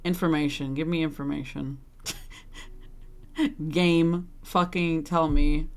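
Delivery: low, held-in anger with pauses; seething. Real, not acted. Streamer demanding information from the game, seething. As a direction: demand it in a low, tightly restrained voice. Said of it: "I am so angry".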